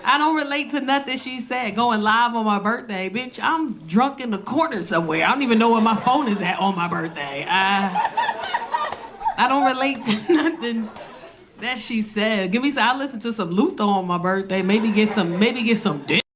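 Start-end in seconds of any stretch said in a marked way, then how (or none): none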